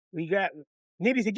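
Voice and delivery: ordinary talk, nothing marked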